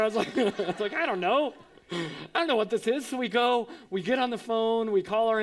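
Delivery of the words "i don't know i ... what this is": higher, amused mock bafflement; laughing, playful helplessness; laughing cluelessness. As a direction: admit you're clueless through a laugh. Jokes about his own cluelessness, laughing.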